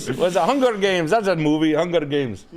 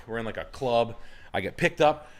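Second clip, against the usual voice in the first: ordinary talk, nothing marked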